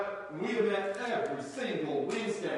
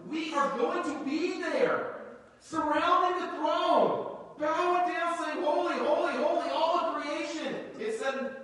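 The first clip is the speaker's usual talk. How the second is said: rising excitement; 5 semitones higher than usual. Real, not acted. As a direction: lift the voice as if already at the throne. Describing heavenly worship.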